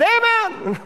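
high, sharp amen; parroted amen; mock eager amen. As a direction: blurt it like a zealous churchgoer. Lady's blind amen.

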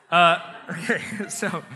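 laughing through the words. Real, not acted. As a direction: let the laugh spill over. Still laughing after joke.

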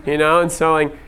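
normal talking voice

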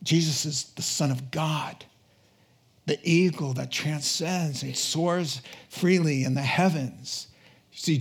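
normal talking voice

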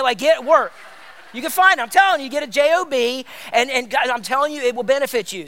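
loud, high-pitched comic exhortation; playful, insistent preacher's urging; funny but serious. Real, not acted. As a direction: push it loud and high, half joking. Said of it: "I'm being funny, but I'm also being serious".

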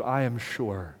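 ordinary delivery

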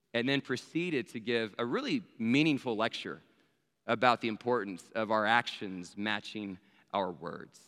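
ordinary delivery, nothing marked

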